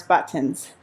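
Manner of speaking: "buttons" with carefully sounded consonants; enunciated. Real, not acted. Coach explaining spring settings, "buttons" carefully enunciated. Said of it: "I just like to enunciate".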